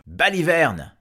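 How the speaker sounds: high, loud outburst of contempt; scornful shout. Acted. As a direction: throw the word back at the dragon. Farmer rejects the dragon's offer. (exclamation méprisante, forte et aiguë)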